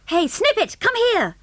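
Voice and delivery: high, urgent call to a dog. A narrator's reading. Calling the lost dog.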